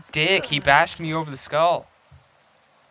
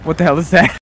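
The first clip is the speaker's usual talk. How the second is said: alarmed; 3 semitones higher, 11 dB louder, faster than usual. Real, not acted. Sees a threat while running.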